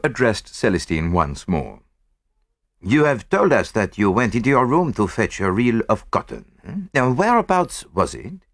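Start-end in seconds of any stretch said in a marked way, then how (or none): 2.8-8.4 lightly voiced, probing French detective; French-accented detective. A narrator's reading. Poirot questions the French maid, politely probing.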